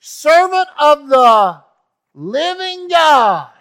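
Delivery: high, loud, slow anguished call; desperate high shout, drawn out; anguished cry. Acted. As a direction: cry his name out in anguish across the den. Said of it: "he cried out in anguish to Daniel".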